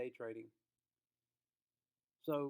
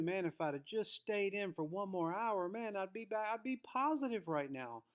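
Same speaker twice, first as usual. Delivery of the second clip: panicked regret; 4 semitones higher than usual. Pretend. Trader who just sold, watching it rise, frantic. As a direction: panic as the price runs back without you. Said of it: "they have a new emotion".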